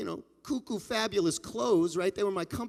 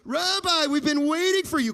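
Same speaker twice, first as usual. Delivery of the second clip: excited welcome; 6 semitones higher, 5 dB louder than usual. Acted. Guests greet the new rabbi.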